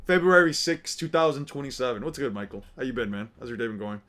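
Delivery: usual speaking voice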